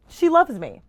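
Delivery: gushing excitement; excited squeal. Real, not acted. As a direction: blurt it out thrilled. Excited repeat of gift.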